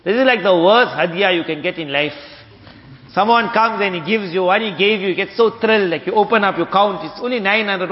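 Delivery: usual speaking voice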